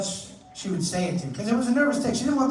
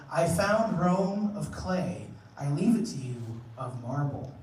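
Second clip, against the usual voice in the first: slow solemn recital; slower than usual. Acted. Solemn quote delivery.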